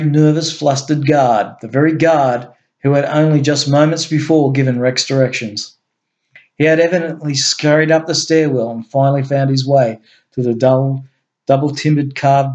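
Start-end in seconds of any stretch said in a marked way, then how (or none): none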